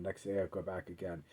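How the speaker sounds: last echo of a monotonous routine; fading refrain. Shown as a demonstration. Third repetition, near trailing off.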